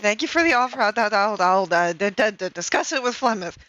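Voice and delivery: tongue-tied, tripping over every word; flustered stammering. Acted. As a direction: babble nonsense syllables, then stutter out thanks. Flustered reply to a flirtatious offer.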